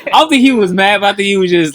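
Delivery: amused protest; laughing. Real, not acted. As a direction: talk through a giggle. Laughing while correcting friend.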